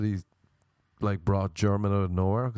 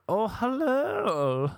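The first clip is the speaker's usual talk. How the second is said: high, drawn-out mock-posh greeting; theatrical English-sounding creep; 11 semitones higher, much slower than usual. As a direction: stretch the vowels in an exaggerated creepy put-on voice. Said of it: "that's how he was talking".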